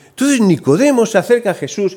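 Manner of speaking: ordinary talk, nothing marked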